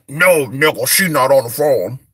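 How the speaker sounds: low voice to fend off telemarketers; deep phone voice. Acted. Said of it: "I wish it was deeper".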